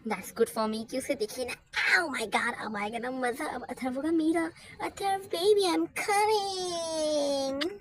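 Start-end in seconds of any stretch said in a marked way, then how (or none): 4.1-7.7 higher, louder, drawn-out sing-song gloat; a sing-song call to her beloved; gloating excitement. Acted. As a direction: savour the trap like a cartoon villain. Villain gloating over oil plan.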